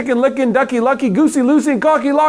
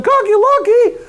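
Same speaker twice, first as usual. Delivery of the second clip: yelling hen; 4 dB louder than usual. A narrator's reading. Chicken yelling to the goose, high and frantic. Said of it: "and yelled".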